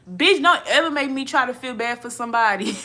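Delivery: loud, high burst breaking into a laugh; exasperated outburst. Real, not acted. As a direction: shout it out, laughing at yourself.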